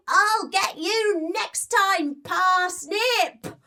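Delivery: high, slow villain mouse call. Acted. Falling Meldrum calls up to Parsnip in a high villain voice. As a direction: call up like a beaten little villain.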